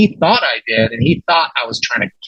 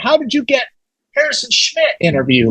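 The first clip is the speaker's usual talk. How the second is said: imitating amazed coworkers, higher pitched; flipping out; 10 semitones higher than usual. Station colleagues asking about the big interview, flipping out. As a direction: retell an incredulous, high-pitched question. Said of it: "everyone at the station was flipping out".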